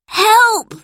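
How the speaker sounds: high, loud cry for help; shout for help. A narrator's reading. Boy grabbed by pickpockets shouts for help. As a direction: yell it out, frightened. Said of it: "Robert shouted".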